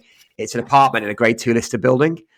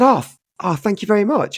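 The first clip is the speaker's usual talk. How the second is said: higher, caught off guard and grateful; surprised thanks; 10 semitones higher than usual. Retold or imitated. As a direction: gush thanks at unexpected praise.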